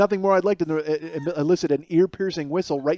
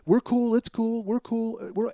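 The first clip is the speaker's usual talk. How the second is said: higher, fading nervous placation; scared backing off; 7 semitones higher than usual. Retold or imitated. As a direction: back off scared, trailing away. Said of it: "he just backs off".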